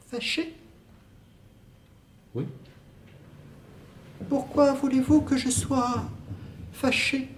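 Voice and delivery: soft high female role; woman's voice. Acted. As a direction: play the distant lover, light and airy. Woman's part in two-voice scene.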